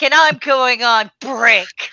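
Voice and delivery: loud, slow, emphatic; retold angry announcement. Bookseller walks off from a rude customer, angrily emphatic. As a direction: announce your exit with loud finality. Retold furious exit line.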